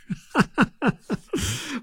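high, light chuckle; short burst of soft, high laughter; laughing. Real, not acted. Host laughs after telling the police officer's punchline, high soft chuckle.

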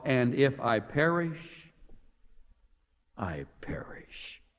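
slowing and fading down to a whisper on "I perish"; quiet, resigned whisper of acceptance; hushed resolve. Shown as a demonstration. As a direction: let "if I perish, I perish" die away to a breathless whisper. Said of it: "Is that a great answer".

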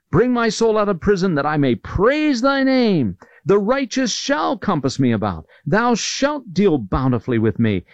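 high-pitched opening easing down, quoting a triumphant psalm; triumphant praise. Retold or imitated. Preacher quoting David praising God from trouble, mildly triumphant. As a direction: proclaim it as mild triumphant praise. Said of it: "how triumphant he was in trouble".

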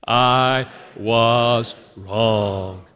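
very slow, one word at a time; drawn-out, low, deliberate practice line; dry-run recitation. Shown as a demonstration. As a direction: lead a group word by word. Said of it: "Let's just do a dry run".

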